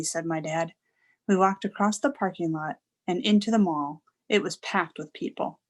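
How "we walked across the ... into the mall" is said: ordinary delivery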